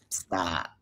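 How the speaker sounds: low, whispered command; hushed dismissal. Retold or imitated. Woman shutting down her critics, hushed dismissal. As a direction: drop it to a whisper.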